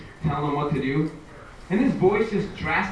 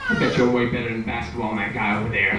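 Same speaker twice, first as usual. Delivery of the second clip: bar guy; 6 semitones lower than usual. Acted. Bar fan voiced as quote.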